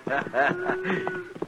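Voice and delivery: a big, high burst of laughter; hearty laugh. Acted. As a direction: boss laughing at the marshal's sleepy habits. Trail boss teasing the marshal.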